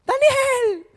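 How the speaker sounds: high, loud, anguished cry of the name; the king calling down into the lions' den, anguished. Acted. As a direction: call out his name in distress. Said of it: "con voz afligida".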